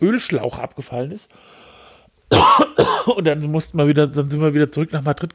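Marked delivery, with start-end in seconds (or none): 2.3-3.0 coughing fit. Real, not acted. Mid-story coughing.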